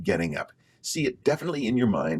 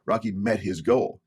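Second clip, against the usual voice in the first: ordinary talk, nothing marked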